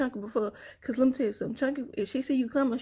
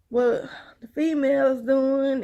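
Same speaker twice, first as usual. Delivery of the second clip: ordinary talk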